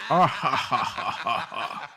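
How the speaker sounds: theatrical evil chuckle; villain laugh. Retold or imitated. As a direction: cackle like a stage villain. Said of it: "He always does the classic villain laugh".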